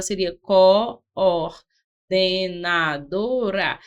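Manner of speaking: coordenadora drawn out, the double O held apart; slow split of coordenadora for the class; syllables split. Shown as a demonstration. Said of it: "cada O ficaria em uma sílaba".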